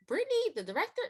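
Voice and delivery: can't believe it's her; incredulous. Retold or imitated. Told she'll be director, a high, astonished double-take. Disbelief at being offered director.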